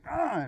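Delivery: high, tight "mm"; mean-mugging grunt. Retold or imitated. Two drivers mean-mug each other, mean-mugging grunt. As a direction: hum a short, high, tough-guy grunt. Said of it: "we mean-mugged each other like".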